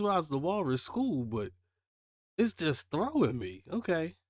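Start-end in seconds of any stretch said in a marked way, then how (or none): none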